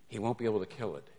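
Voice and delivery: normal talking voice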